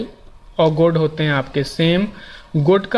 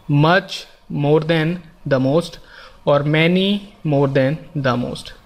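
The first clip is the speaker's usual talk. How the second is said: very slow, each form spelled out; slow teaching demo; much slower than usual. Shown as a demonstration. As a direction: say every form slowly for students to copy. Teacher spells out example forms. (उदाहरण बहुत धीरे-धीरे बोलना)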